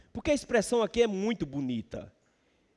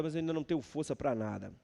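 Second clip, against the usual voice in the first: softer, fast, drained admission; worn-out sigh of a line; weary psalmist; 4 semitones lower, 8 dB quieter, faster than usual. Acted. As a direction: let it sink down, spent. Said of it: "Mas ele diz agora".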